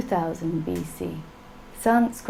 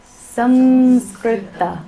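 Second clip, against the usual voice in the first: Samskrita drawn out slowly, louder; slow emphatic Samskrita; 4 dB louder, much slower than usual. Shown as a demonstration. Teacher modelling the language name for students, slow and emphatic. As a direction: lay out Samskrita slowly, syllable by syllable. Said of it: "what is the language we're studying".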